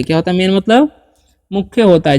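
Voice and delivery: usual speaking voice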